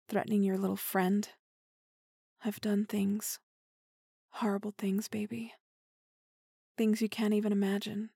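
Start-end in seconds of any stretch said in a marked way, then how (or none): 2.4-5.5 hushed confession whisper; dark whisper. Acted. Confessing crimes to partner.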